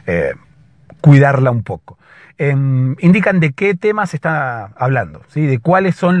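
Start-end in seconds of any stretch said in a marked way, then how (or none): 0.1-2.0 dropping lower toward the end. Real, not acted. Teacher telling students they will spare their voice, lower tone. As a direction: ease down a tone to spare a strained voice. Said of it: "hablar un tono más abajo".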